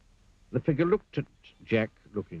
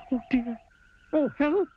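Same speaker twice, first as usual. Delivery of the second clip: high, louder frightened cry; panicked cry for help; 5 dB louder than usual. Acted. Jack panics above crocodile.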